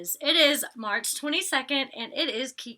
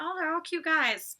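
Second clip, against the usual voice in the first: gushing; 7 semitones higher than usual. Retold or imitated. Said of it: "I'm like".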